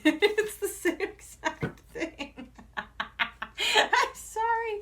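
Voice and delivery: high, slow, helpless laughter through the words; laughing. Real, not acted. Reader apologising to her viewers while laughing hard. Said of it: "that's so funny".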